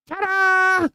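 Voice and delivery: a squeaky, very high ta-da; goblin queen entrance. Retold or imitated. Goblin queen appearing out of nowhere, squeaky showy ta-da. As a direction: pop out of nowhere with a showy flourish. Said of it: "appear out of nowhere and be like".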